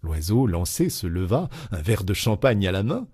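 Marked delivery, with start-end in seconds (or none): none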